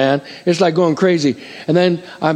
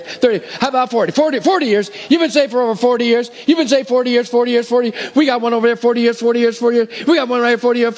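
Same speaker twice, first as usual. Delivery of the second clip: rapid auctioneer patter, climbing higher; auctioneer call; 6 semitones higher than usual. Pretend. Counts years saved like auction.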